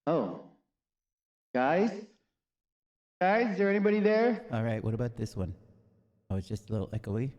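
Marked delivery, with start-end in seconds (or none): 1.6-4.4 high, loud call. Real, not acted. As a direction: call out to the room. Calling out to others.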